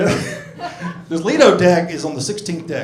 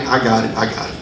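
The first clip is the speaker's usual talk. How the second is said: ordinary talk, nothing marked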